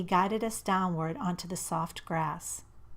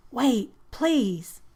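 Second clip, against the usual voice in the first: pleading call; 5 semitones higher, 7 dB louder than usual. A narrator's reading. Begging her to stay.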